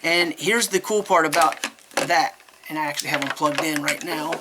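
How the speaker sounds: ordinary delivery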